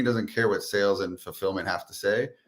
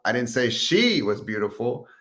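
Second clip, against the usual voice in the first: she pitched up and held; stress on she; 2 semitones higher than usual. Shown as a demonstration. Coach shows another reading, stressing she. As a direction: punch the word she. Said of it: "you emphasize each word".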